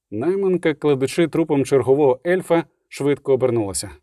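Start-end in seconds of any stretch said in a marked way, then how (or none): none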